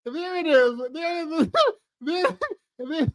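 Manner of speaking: high, giggling "there it is"; laughing. Real, not acted. Laughing as she catches him on the clip. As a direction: crack up as you point it out.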